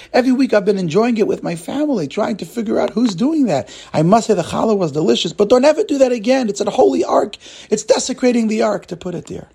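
high-pitched, animated scolding; scoffing cleaner. Acted. Cleaner telling Joseph never to do it again, animated scolding. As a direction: lecture him in exasperated disbelief.

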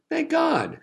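high-pitched, grinning exclamation; pleased, lifted-up thanks; grinning relief. A narrator's reading. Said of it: "said Reb Gedalia, grinning".

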